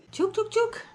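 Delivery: high sing-song chicken call. Real, not acted. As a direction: call the hens in high and bright. Calling the backyard chickens.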